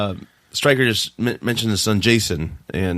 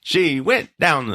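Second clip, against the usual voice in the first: slow, higher, lightly imitated actor voice; Christopher Walken; 6 semitones higher, much slower than usual. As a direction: draw it out in a mock actor impression. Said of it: "sound like Christopher Walken".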